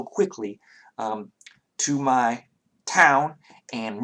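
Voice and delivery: reluctant Southern accent, hammed up for the table. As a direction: play it up as a friendly Southern stable hand. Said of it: "abhor the southern accent".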